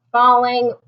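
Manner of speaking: usual speaking voice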